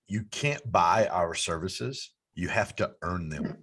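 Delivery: quoting a slogan, measured and low; slow, low quoting. Retold or imitated. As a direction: read the motto slowly and deeply. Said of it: "you say".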